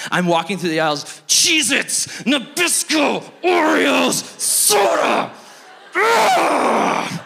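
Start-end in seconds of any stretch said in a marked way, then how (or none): 1.3-7.2 high, loud shouted frustration; furious rant. Retold or imitated. Pastor angry in the store aisles, high loud shouting. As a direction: yell the grocery names in rage. Raging while walking aisles.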